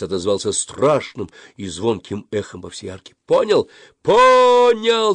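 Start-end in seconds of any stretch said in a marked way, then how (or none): 3.3-3.7 high, loud short call; shouted question. A narrator's reading. Boy checks his friend got it, shouting. Yeller asking friend under arch. 4.0-5.1 very high, loud holler; yelled reply. A narrator's reading. Suren answers his friend under the arch, yelling. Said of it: "заорал Сурен".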